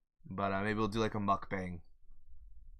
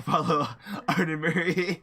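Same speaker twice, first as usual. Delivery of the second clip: laughing; 7 semitones higher, 8 dB louder than usual. Real, not acted. Laughing shoutout.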